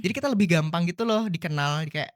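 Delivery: ordinary talk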